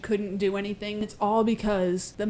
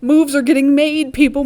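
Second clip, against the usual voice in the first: loud, higher hype call; excited shout; 5 semitones higher, 11 dB louder than usual. Real, not acted. Host hyping viewers about the uprising, excited shout. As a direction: announce it loudly to the whole crowd. Hyped announcement to the audience.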